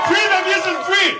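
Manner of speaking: high, slow slogan shout; rousing rally cry. Real, not acted. Speaker urges crowd to leave kids a free nation, rousing rally cry. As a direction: lift the pitch and stretch each word for the crowd.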